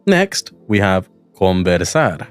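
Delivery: ordinary talk, nothing marked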